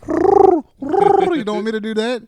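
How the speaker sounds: very high, loud and slow; taunting voice. Retold or imitated. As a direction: stretch it out in a shrill tease. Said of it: "she starts going".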